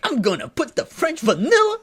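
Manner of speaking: very high, loud, gleeful impersonation; imitated excitement. Homeless guy announcing his coffee flavors, imitated as thrilled. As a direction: play him bursting with joy over flavors. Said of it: "so excited about it".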